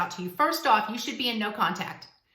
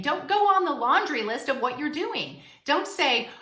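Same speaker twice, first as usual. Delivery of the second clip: usual speaking voice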